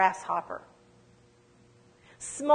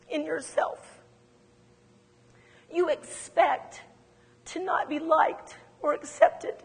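tender, earnest counsel; 4 semitones higher, 3 dB quieter than usual. Retold or imitated. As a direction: gently speak truth to a hurting friend. Retelling a tender prophetic word to her.

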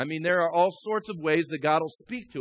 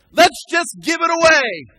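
high, loud preacher's proclamation; rousing shout; 10 semitones higher, 11 dB louder than usual. Real, not acted. Pastor proposing to the church to give it away, rousing shout. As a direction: build to a big, drawn-out finish.

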